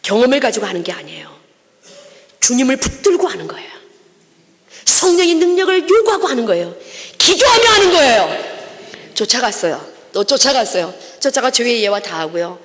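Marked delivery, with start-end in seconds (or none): 2.4-8.5 rising to a loud preaching cry; building emphasis. Real, not acted. Preacher exhorting the congregation, building louder. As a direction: build it up, hit 기도하며 hardest.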